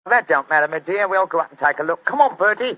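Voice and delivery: loud, high Cockney workman; brash Cockney council man at the door; Cockney accent. Acted. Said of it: "My Cockney accent".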